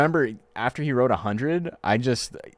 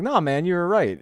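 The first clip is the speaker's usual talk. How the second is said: higher, a bit louder "no, man"; exasperated reassurance; 9 semitones higher, 4 dB louder than usual. Retold or imitated. Streamer reassuring a friend over his pronunciation. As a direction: insist he had it right, exasperated. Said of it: "it's like".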